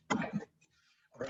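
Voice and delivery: hushed secret aside; giggly prank whisper. Real, not acted. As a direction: whisper it to the camera. Sneaking off to tell camera.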